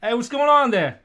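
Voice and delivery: loud, high New York challenge; New York accent. Acted. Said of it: "gotta do a New York accent".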